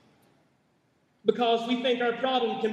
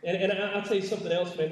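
ordinary delivery

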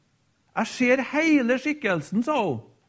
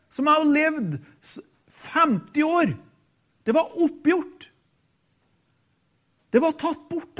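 lifted, fervent sermon voice with a higher pitch; rising fervent preaching; 4 semitones higher than usual. Acted. Preacher retelling a dying woman's vision, rising fervor. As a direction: carry the congregation up into the release.

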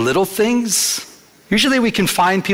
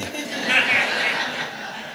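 breathy laugh; 7 semitones higher, 7 dB quieter than usual. Real, not acted. Delighted at Eric calming her.

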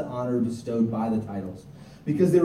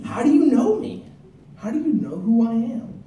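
high, louder startled asking; shocked question; 11 semitones higher, 4 dB louder than usual. Acted. Nathanael asking Jesus, higher and startled. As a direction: ask it as if caught off guard, amazed. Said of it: "kind of shocked".

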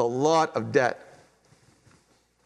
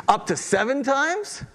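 eager high question; 10 semitones higher than usual. Retold or imitated. Peter pitching a big number to Jesus, eager rising question. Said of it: "I'm giving you the big number here".

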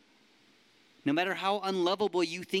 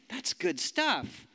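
ordinary talk, nothing marked

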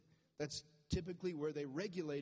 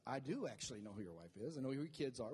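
Jesus answering calmly; 5 semitones lower, 3 dB quieter, faster than usual. Acted. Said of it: "Jesus is like".

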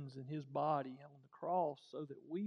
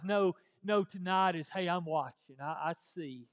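loud, emphatic correction; 9 dB louder than usual. Real, not acted. Preacher correcting the congregation, loudly. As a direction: cut them off and set it straight.

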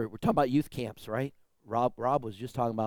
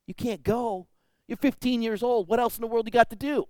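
retold high, louder disbelief; incredulous disbelief; 11 semitones higher, 6 dB louder than usual. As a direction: act out the shock of a refusal, pitched high. Pastor retells disbelief at kid.

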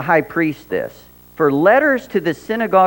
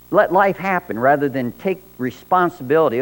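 ordinary delivery

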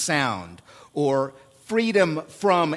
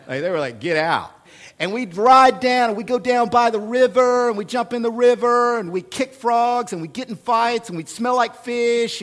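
excited; 5 semitones higher than usual. Real, not acted. Pastor telling the church about boyhood rides, excited.

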